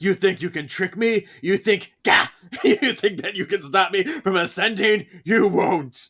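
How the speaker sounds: loud, high, sneering taunt; mock furious villain. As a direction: taunt her like a villain about to ascend. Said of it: "and then she's like".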